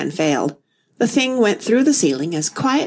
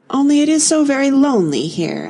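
higher, mildly melancholy lament; 7 semitones higher, 3 dB louder than usual. A narrator's reading. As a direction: say it with a touch of lonely sorrow. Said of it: "in a melancholy voice".

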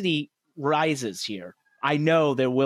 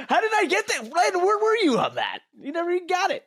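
much higher, giggling amazement; host stunned he guessed it, much higher laughing; laughing disbelief. Real, not acted. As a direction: squeal through the laughter.

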